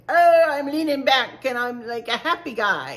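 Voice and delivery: a relaxed, pleased little guy leaning back; laid-back happy snowman. Retold or imitated. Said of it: "He kind of sits like".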